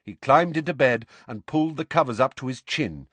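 usual speaking voice

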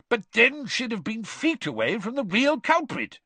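high, pressing objection; impatient. A narrator's reading. Diggory objecting to Weasley's theory, impatient. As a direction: cut in impatiently. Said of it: "said Mister Diggory impatiently".

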